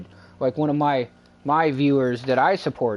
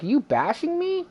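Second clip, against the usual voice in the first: high-pitched incredulous question; retold challenge; 13 semitones higher than usual. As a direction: re-enact challenging someone, pitch jumping up. Retells confronting the other streamer.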